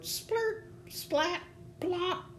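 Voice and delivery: very high little character voice; leaves crying out. A narrator's reading. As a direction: squeak out each sound separately, high. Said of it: "They cried out".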